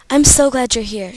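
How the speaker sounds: ordinary delivery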